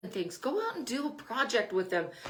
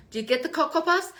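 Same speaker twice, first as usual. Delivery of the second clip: put-on raised, quick little-kid question; hopeful child; 7 semitones higher, 5 dB louder, faster than usual. Child asking parent for cereal, hopeful and quick. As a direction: ask hopefully like a small child.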